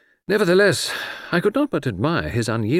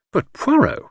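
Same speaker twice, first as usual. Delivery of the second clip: high-pitched delighted exclamation; excited cry; 4 dB louder than usual. A narrator's reading. Friend reacts to a flattering letter, crying out excitedly. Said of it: "I cried".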